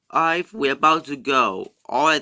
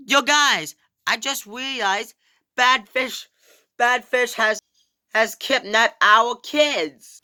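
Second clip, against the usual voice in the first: put-on higher, worried little kid voice; kid character voice; 7 semitones higher than usual. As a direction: sound like a panicking kid. Kid characters panic about kidnapping.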